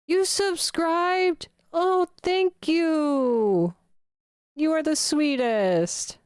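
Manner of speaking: high, slow, drawn-out delighted thanks; gushing surprise. Real, not acted. Streamer thanking a new subscriber, high and gushing. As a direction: gush over the surprise, stretching every word.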